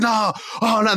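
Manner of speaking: high fake wail of dismay; mock dismay. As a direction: cry out like a betrayed wrestler. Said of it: "I'd be like".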